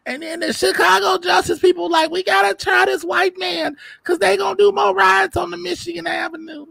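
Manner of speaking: sustained squeaky falsetto rant; terrible put-on voice. Resident pitching an episode of Chicago Justice, in terrible high falsetto. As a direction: push the whole rant up into a silly high voice. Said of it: "that terrible ass voice".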